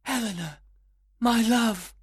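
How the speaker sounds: lovestruck cry. A narrator's reading. Lysander wakes and sees Helena, high lovestruck cry. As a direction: exclaim in rapture, building. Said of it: "he cried out".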